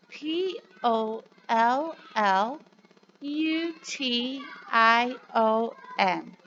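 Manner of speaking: put-on slow, clear letter-by-letter spelling for children. Shown as a demonstration. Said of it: "can you say it with me".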